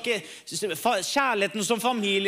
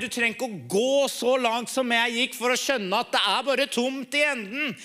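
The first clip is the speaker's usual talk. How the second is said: preaching appeal; 4 semitones higher than usual. Acted. Preacher urges the Saturday crowd of boys, fervent appeal. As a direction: press the gospel home to the young men.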